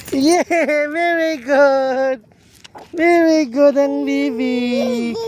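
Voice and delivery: high, slow sing-song praise. Real, not acted. Cheering toddler's walk.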